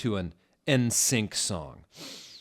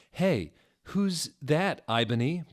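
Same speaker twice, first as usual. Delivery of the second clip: curious slow question; 8 semitones higher, 4 dB louder than usual. Acted. Bloody Mary asking.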